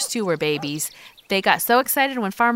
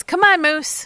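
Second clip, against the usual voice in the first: calling out; 10 semitones higher, 6 dB louder than usual. Real, not acted. Calling the dog on walk.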